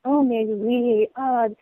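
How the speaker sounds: coy French accent, slipping into French. Shown as a demonstration. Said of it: "I like to hear the French accent".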